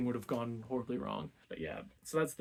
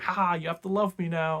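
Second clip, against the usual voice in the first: loud, higher mock "ha ha"; imitated smug victory cry; gloating trickster; 4 semitones higher, 7 dB louder than usual. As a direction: crow like a hammed-up schemer. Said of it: "he sort of tricks her".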